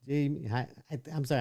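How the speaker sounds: ordinary delivery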